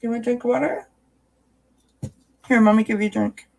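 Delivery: high, coaxing pet voice. Real, not acted. Mommy offering Charlotte water, high coaxing baby talk. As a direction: lure her in sweetly, voice up high.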